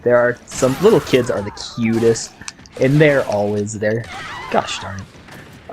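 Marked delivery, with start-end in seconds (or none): 4.5-5.7 whispered gosh darn it, slow; slow exasperated whisper; frustrated whisper. Real, not acted. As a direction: hiss it under your breath at the game. Frustrated at game mid-story.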